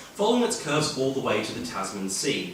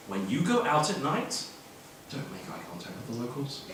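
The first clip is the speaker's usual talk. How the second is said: quoted mantra; 7 dB quieter than usual. Retold or imitated. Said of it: "repeated the mantra".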